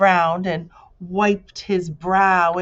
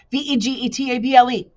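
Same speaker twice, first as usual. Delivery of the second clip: fast, higher letter run; rapid-fire spelling; 7 semitones higher, 6 dB louder, faster than usual. A narrator's reading. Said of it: "he spelled as fast as he could".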